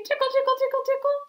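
high squeaky tickle tickle; playful tickle. Pretend. Teacher tickles the sheep's nose, squeaky high. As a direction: lift the voice way up and tease.

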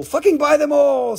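loud, high comic outburst; mock exasperation. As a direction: urge them on with playful force. Mock-exasperated answer to hesitant buyers.

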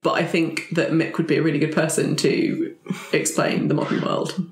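ordinary talk

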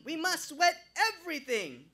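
very high, loud Jamaican shout; shouting Jamaican accent. A narrator's reading. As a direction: yell it frantically, Jamaican accent. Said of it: "rambling even louder".

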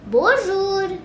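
high drawn-out hello; sing-song greeting. Shown as a demonstration. Quiz answer for greeting a teacher, sing-song.

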